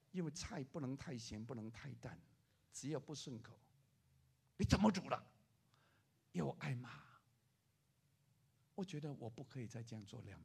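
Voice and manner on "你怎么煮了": loud sharp scold; a hint of an angry husband. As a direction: snap at the wife over dinner. Scolding wife over food.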